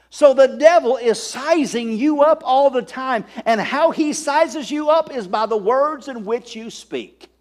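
preaching. Acted. Pastor warning the congregation about the devil, preaching. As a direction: warn them with fire.

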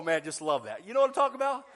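usual speaking voice

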